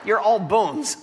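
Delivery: normal talking voice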